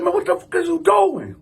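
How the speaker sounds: high-pitched incredulous challenge; retold indignation. Man demands answers from cousin after vase hit, high indignation. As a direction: reenact confronting someone, voice jumping up.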